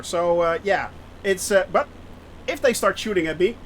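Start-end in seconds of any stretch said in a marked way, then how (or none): none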